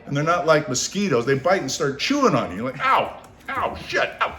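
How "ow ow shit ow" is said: high yelping ows; yelps of pain. Retold or imitated. Doing the naked ant dance, yelping in pain. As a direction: hop and yelp. Ants biting them.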